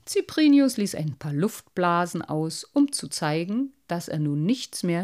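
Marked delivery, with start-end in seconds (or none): none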